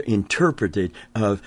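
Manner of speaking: ordinary talk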